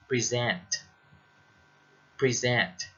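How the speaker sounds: "present" as pree-ZENT; "present" said as the verb, stressed on ZENT; second-syllable stress. Shown as a demonstration. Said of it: "stress on the second syllable".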